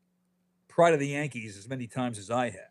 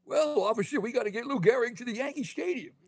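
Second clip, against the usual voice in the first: put-on much higher old cowboy voice; Walter Brennan impression; 11 semitones higher than usual. Mimicking the movie for podcast hosts, high old-timer voice. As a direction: squeak it out like a creaky old sidekick. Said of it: "a cowboy with a Boston accent".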